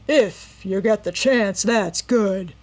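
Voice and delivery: loud, slow rough mockery; mock her threat coldly; mocking menace. A narrator's reading.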